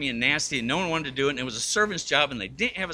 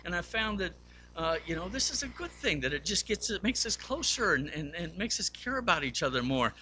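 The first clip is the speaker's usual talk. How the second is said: higher, softer sincere explanation; earnest confiding; 5 semitones higher, 5 dB quieter than usual. Retold or imitated. Joe to puzzled Bob, higher soft sincere confiding. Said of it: "And he goes".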